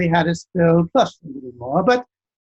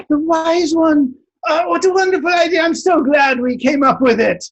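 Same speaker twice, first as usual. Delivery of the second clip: overjoyed king; 10 semitones higher than usual. Acted. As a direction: gush with over the top glee, much higher. King mock delighted.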